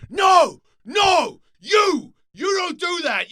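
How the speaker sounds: very high, full-force shouted scolding; separate, screamed words of outrage; screaming. Retold or imitated. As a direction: imitate an owner yelling someone out. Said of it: "starts screaming at the guy".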